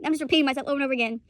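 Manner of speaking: ordinary talk, nothing marked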